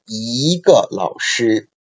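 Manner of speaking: "一个老师" said slowly, 一 on a rising second tone; slow tone demo. Shown as a demonstration. Teacher reads the example to the class, slow and deliberate. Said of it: "changed into second tone".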